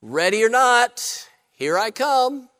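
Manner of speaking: drawn-out calling, rising at the end; hide-and-seek call. Retold or imitated. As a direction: call out slowly like the seeker. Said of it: "then he yells out".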